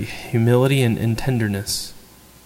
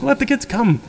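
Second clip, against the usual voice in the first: much higher, eager urging; worked up; 11 semitones higher than usual. Retold or imitated. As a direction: wave the children in, stirred up. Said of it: "Jesus gets worked up".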